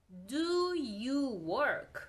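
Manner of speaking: very slow, stretched DO YOU WORK; slow model question. Shown as a demonstration. Teacher models a question for learners, slowly. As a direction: draw out each word of "do you work".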